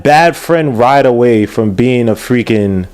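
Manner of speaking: ordinary delivery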